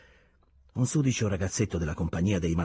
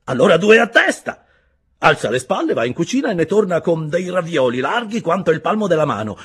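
higher, louder, lively telling with laughs; cheerful storyteller figure; 12 semitones higher, 10 dB louder than usual. A narrator's reading. Fellow prisoner recalling Ulaanbaatar, lively and laughing. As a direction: tell it like a happy memory. Another prisoner tells an anecdote.

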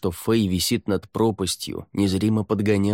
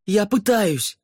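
high, exasperated yell; shouted retort; 13 semitones higher, 3 dB louder than usual. A narrator's reading. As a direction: yell back under strain. Shouted retort during rescue.

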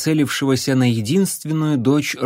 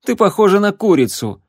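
snapping; 7 semitones higher, 4 dB louder than usual. Acted. Leon snapping at Abby.

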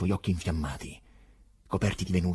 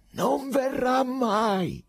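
high, loud, slow roar of denial; terrible shout; 6 dB louder, much slower than usual. Acted. Pilate shouting down the prisoner, terrible high slow roar. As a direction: bellow it so he staggers. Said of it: "gridò a un tratto Pilato con voce così terribile".

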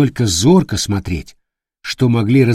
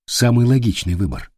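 ordinary delivery, nothing marked

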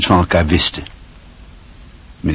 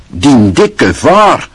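drawn-out, slow, louder jeer with a higher voice; mock the other boy's father loudly; taunting shout; 7 semitones higher, 4 dB louder, much slower than usual. A narrator's reading. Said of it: "Hij riep". (langgerekt scheldend geroep)

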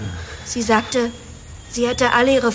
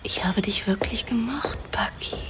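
soft whisper, intimate and regretful; a sad whispered goodbye; whispered confession; 4 semitones lower, 6 dB quieter than usual. Acted. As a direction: breathe it to him softly. Farewell confession to the detective.

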